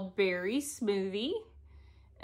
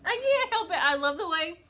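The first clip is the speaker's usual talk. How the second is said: high, loud laughing through the words; can't stop giggling while talking; giggling fit; 9 semitones higher, 5 dB louder than usual. Real, not acted. Vlogger to viewers, giggling at herself sniffing floss, laughing.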